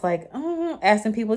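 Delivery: normal talking voice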